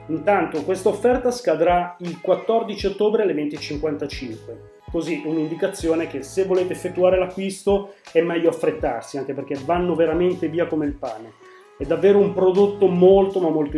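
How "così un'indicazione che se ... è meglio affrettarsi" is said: normal talking voice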